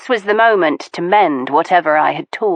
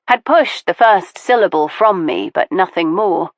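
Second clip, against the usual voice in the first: usual speaking voice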